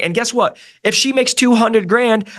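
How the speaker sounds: normal talking voice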